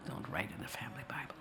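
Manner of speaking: hushed whisper, as if someone might overhear; whispered warning. Retold or imitated. A family member whispering a warning about the Bible. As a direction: breathe the words out like a secret warning.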